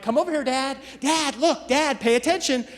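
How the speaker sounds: put-on high, eager kid voice calling Dad; a child tugging at Dad for attention.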